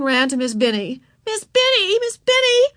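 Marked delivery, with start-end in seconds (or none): 1.2-2.7 put-on very high wailing little girl; upset child's plaintive cry; distressed cry. A narrator's reading. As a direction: call out for the teacher's help. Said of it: "she cried".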